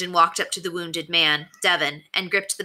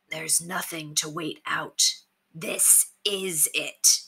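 steely, direct; 5 dB quieter, slower than usual. A narrator's reading. Leader faces down the crowd of bandits, steely. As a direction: weigh each word, steely and final. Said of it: "His voice was steely, direct".

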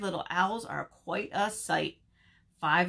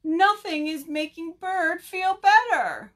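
high, loud tearful wail; hammed-up wail of distress; crying together; 9 semitones higher, 7 dB louder than usual. A narrator's reading. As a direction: wail it like upset friends. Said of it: "cried Bird's friends together".

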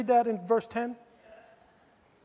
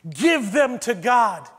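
usual speaking voice

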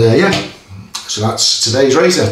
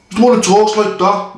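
put-on a failed stab at a Brummie accent, pitched higher; half-hearted Brummie accent, higher voice; Brummie accent attempt; 8 semitones higher than usual. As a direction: try a Brummie accent and miss it. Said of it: "I was trying to do a Brummie accent again".

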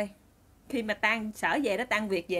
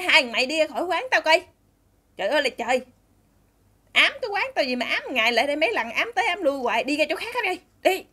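acted shop owner yelling, raised pitch; loud angry scolding; 4 semitones higher, 4 dB louder than usual. Shop owner chasing two shoeshine kids out, loud angry scolding. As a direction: tell them off loudly to chase them away. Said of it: "nạt quá trời mà nạt lớn luôn".

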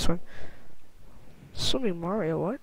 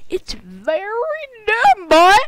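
high, slow drawn-out complaint; exasperated shout; 11 semitones higher, 4 dB louder, slower than usual. Real, not acted. Gamer scolding the game, high drawn-out complaint. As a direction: stretch it out in exasperation.

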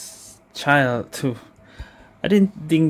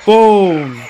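raised, rallying call building to a loud boom; excited cheer; 5 semitones higher, 6 dB louder, slower than usual. Real, not acted. Player celebrating an ulti burst, excited. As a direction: cheer the big move, punching the last word.

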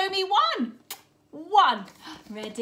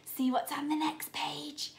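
hushed secretive whisper; 2 semitones lower, 8 dB quieter than usual. Acted. Teacher drawing children to the next page in a whisper.

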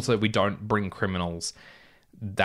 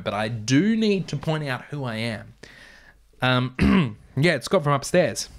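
usual speaking voice

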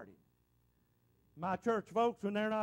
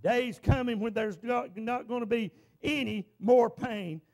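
rising, louder preacher's cadence, slowed at peaks; preaching; 5 dB louder than usual. Real, not acted. Preacher to his church, preaching at full force. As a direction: preach it hard to the congregation.

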